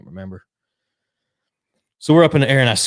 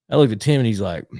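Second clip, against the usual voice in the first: ordinary delivery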